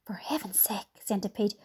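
hushed, pleading whisper; soft whisper. A narrator's reading. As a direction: shush him urgently. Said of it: "James said softly".

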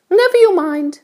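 high-pitched storybook ladybird voice; kindly ladybird. A narrator's reading. Ladybird explains things to James, kindly high voice. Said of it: "said the ladybird".

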